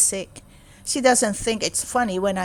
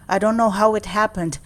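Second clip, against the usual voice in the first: ordinary delivery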